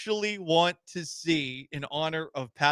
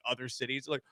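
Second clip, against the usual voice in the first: normal talking voice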